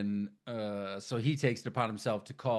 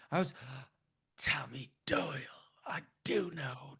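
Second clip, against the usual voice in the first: breathless whispered plea with a gasp; 4 dB quieter than usual. Retold or imitated. Tommy whispering urgently to the doctor. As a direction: hush it, gasping for breath.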